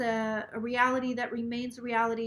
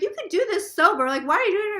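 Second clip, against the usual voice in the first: laughing disbelief; 8 semitones higher, 8 dB louder, faster than usual. Retold or imitated. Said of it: "She's laughing".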